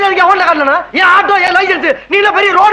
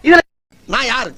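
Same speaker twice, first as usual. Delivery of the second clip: much softer hushed scolding; hushed argument; 7 dB quieter than usual. Acted. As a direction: argue under your breath. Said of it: "மெதுவா பேசுங்க". (மாமனாருக்குக் கேட்காமல் மனைவியைத் திட்டுதல், தாழ்ந்த குரல்)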